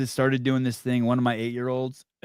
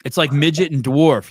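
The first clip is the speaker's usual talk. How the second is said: ordinary talk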